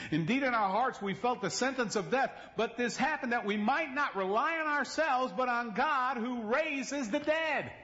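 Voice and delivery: high, slow fervent reading; scripture read with fervor. Acted. Preacher reading Paul to the church, high and fervent. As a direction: lift the verse up.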